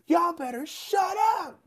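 high, slow mock yell; bus driver yelling. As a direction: bark it at a noisy bus. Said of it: "The bus would say".

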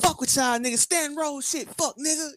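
high, loud mocking rant of an offended Keith; a hammed-up Keith snapping back at fans; mock angry Keith.

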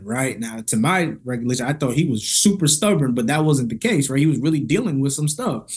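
ordinary talk

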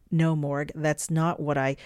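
ordinary delivery

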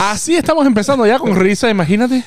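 high and loud through laughter; giggling as he talks. Real, not acted. Laughing start of show.

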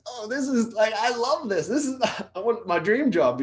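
very high, giddy gushing with a laugh; gushing about a dream comedy job; gushing delight. Retold or imitated. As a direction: squeal it like a starstruck fan.

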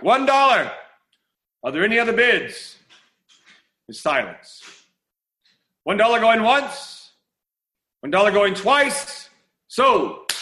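slow auctioneer's call, going once, going twice; auctioneer calling bids. Acted. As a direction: auctioneer calling back to the room, slow, high and loud. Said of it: "The auctioneer calls back".

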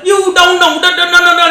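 high, loud, choppy Cantonese accent imitation; choppy Cantonese mimic. Comic doing a Cantonese bit for a friend, choppy accent. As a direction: chop the words like a Cantonese uncle. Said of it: "Cantonese is more choppy".

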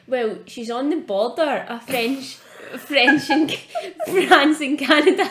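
slow, loud, giggling retold quote; can't get the words out for laughing; friend's confident claim, retold while laughing; laughing through quote. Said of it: "And then she was like".

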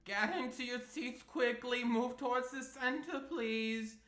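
bossy schoolteacher calling the class in; Miss Roper. Acted. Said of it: "Miss Roper".

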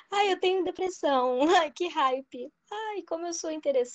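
high whiny mock teen voice; mock a self-pitying teenager; mocking Gen Z. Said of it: "acha hype falar que tá depressivo".

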